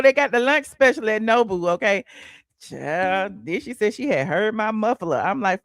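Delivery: normal talking voice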